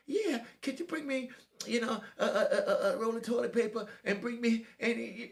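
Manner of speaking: high-pitched mock feminine man's voice; put-on effeminate voice. Young inmate asking the older porter for supplies, effeminate. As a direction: mimic him in a squeaky high register. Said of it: "That ain't the way you talk".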